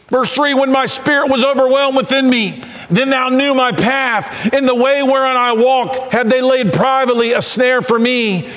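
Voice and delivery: high, driving scripture preaching; full-force preaching. Acted. Preacher expounding David's fear in the cave, full-force preaching.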